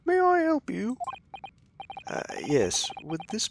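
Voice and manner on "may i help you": much higher, louder maid at the door; English maid voice. Acted. Maid greeting lawyers at the door, in a high voice. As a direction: play the housemaid answering the door, pitched way up. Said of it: "That was a real-life English maid".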